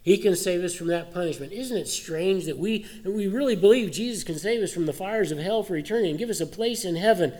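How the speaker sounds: lifted, urgent preacher's voice; fervent preaching. Real, not acted. Pastor stirs the congregation, lifted and urgent. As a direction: press the point home from the pulpit.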